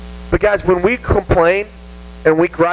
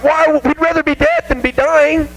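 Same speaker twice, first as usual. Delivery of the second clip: crank the whine up, pleading; hammed-up whining crowd; whining Israelites; 9 semitones higher than usual. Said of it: "And so, like".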